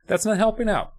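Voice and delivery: high-pitched mock scolding; sarcastic. Real, not acted. Narrator on Nicole only screaming during the fight, sarcastic. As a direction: point out the uselessness with sarcasm. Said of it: "if you couldn't pick up on my sarcasm".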